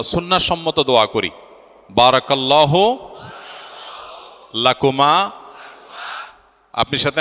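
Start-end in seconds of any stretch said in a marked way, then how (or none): 1.9-5.3 slow, drawn-out phrases with rising ends; leading a prayer. Real, not acted. Officiant leading guests in the newlyweds' blessing, slow call-and-repeat. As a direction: call it out in pieces for a crowd to echo.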